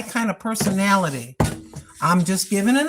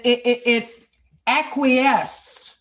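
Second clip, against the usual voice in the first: ordinary talk, nothing marked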